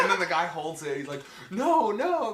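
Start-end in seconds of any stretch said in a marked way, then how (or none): 1.5-2.3 mock panic. Victim panicking retold.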